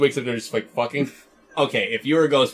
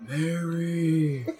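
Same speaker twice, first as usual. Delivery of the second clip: moaning whisper; 3 semitones higher, 3 dB quieter, much slower than usual. Pretend. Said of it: "whisper in the guy's voice".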